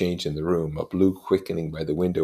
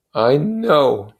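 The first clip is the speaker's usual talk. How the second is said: definite; 9 semitones higher, 9 dB louder than usual. A narrator's reading. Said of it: "he said definitely".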